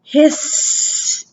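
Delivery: slow hissing snake sound; hissed. A narrator's reading. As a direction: draw out the hiss slowly. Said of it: "out came a hiss".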